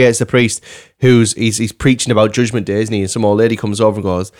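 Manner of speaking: ordinary talk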